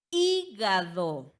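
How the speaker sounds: "hígado" lento, con HÍ fuerte y larga; énfasis en la sílaba tónica. Shown as a demonstration. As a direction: separa y marca fuerte el HÍ de "hígado". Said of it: "¿dónde recae?". ("hígado" silabeado, acentuando la antepenúltima: HÍ-ga-do)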